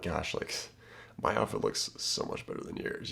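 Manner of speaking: soft, near-whispered teasing line; mock-smug murmur; playful hushed tease. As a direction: tease her under your breath. Teasing example line to girl.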